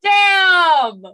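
high, drawn-out impressed holler; loud exclamation. Retold or imitated. Reader reacting to a fight scene, long high shout. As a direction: belt it out as one long whoop.

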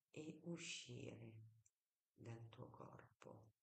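soft, slow meditation whisper; guided meditation. Shown as a demonstration. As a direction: hush them into calm, slowly. Iniziamo insieme con una brevissima meditazione guidata.

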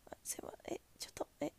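soft, breathy-free hushed apology, almost voiceless; apologetic. Retold or imitated. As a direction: apologize under your breath. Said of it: "申し訳なささを".

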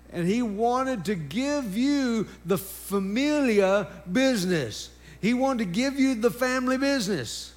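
high-pitched, driving sermon delivery; energetic preaching. Real, not acted. Preacher tells congregation of the family business, energetic preaching. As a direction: preach it emphatically, punching key words.

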